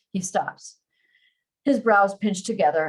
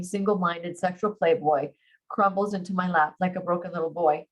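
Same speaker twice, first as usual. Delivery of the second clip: plain reading voice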